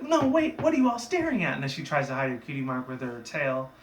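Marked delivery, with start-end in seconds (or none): 0.0-1.5 high, flustered cartoon protest; hammed-up alarm at being exposed; mock panic. Exposed pony hiding her mark.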